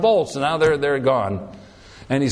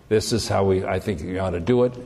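calm advice; 7 semitones lower than usual. Retold or imitated. Wise person talking calmly.

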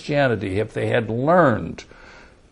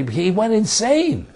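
ordinary talk, nothing marked

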